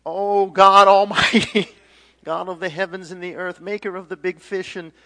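loud, high cry to God; awestruck prayer. Acted. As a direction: call out a fervent improvised prayer. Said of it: "And they're like".

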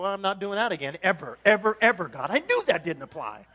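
loud, hammed-up frustrated vow; acted huffy outburst; mock exasperation. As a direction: vent loudly after an embarrassing failure. Acts rejected messenger venting.

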